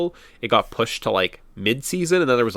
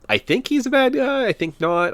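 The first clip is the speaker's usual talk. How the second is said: high-pitched, doubtful self-quote; mock puzzled recollection; unsure guess; 13 semitones higher than usual. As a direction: wonder it aloud, pitched up. Acts out own puzzled guess mid-game.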